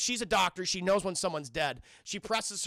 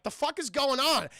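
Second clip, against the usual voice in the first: higher, louder acted disbelief; bewildered outburst; 6 semitones higher, 6 dB louder than usual. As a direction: blurt it out as a baffled onlooker. Acts out bewildered Marines.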